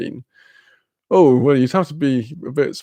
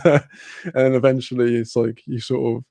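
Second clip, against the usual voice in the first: usual speaking voice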